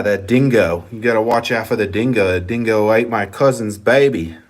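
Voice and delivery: rusty, hammed-up Australian accent; badly faked Australian accent. Shown as a demonstration. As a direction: do a poor imitation Aussie accent. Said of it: "Oh, it's really bad".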